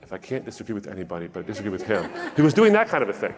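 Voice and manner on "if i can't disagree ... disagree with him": quick, soft muttering under the breath; muttering to himself. Retold or imitated. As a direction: imitate a puzzled man muttering by his car. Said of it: "he was muttering to himself".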